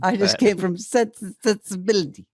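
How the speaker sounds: high mock posh British accent. Mock posh British accent naming her last film. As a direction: drop the film title like a snooty star. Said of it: "I thought that was a terrific take".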